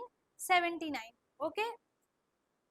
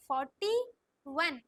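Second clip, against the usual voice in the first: Forty rising, pause, then वन; slow dictation. Shown as a demonstration. Teacher models the number for pupils, slow and split. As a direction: split forty and वन with a clear gap. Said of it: "slow slow बोलो".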